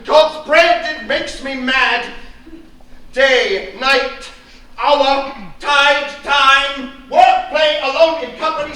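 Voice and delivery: loud hammering tirade; father ranting at nurse and wife; furious ranting. Acted. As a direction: pound every word out.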